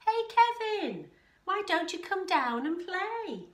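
high, loud call up the tree; shouted invitation. A narrator's reading. Wombat calling Kevin down to play, shouted. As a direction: call out cheerily to a friend up high. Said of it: "shouted one day".

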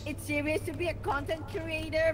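high, loud mock-stern scolding. Player scolds Ryder for snacking on a mission, mock-stern high shout. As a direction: scold him like a bossy teacher.

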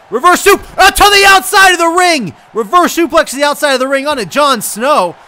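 loud, high-pitched shouted commentary; excited wrestling call. Real, not acted. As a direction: shout the big move as it lands. Big move called live.

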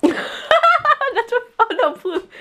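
high giggly voice; laughing through the words. Real, not acted.

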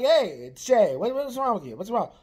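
worried and startled on the phone; alarmed calling out. Real, not acted. Alarmed asking brother what's wrong.

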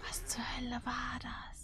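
hushed scared whisper. Real, not acted. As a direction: whisper it in fear. Scared player in horror game.

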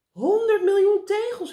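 high, loud call of alarming news; excited cry. A narrator's reading. Dolly calls out her news to meneer Pen, excited cry. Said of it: "riep Dolly".

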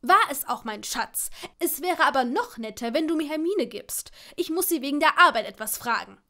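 loud, impatient wheedling; squabbling. A narrator's reading. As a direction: argue loudly for the phone. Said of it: "wie die beiden sich rangelten".

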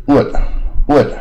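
"wood" said slowly, u sound leaning toward an r; drawn-out wood. Shown as a demonstration. Teacher shows students the r-coloured u in wood. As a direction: stretch "wood" with an r-coloured vowel. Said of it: "它是带耳的音".

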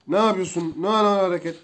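ordinary delivery

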